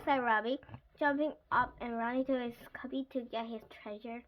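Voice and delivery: usual speaking voice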